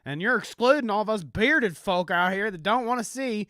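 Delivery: high, loud, riled-up Southern bumpkin; Southern country accent. Acted. Bearded folk objecting to rainbow flags, Southern country accent. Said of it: "every time I do my, uh, country accent".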